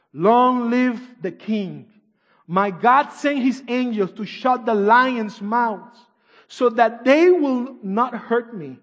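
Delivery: slow, loud, high proclamation; Daniel proclaiming to the king, slow and loud; proclaiming loudly. Acted. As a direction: declare it to the king slowly and loudly. Daniel answers the king, preached.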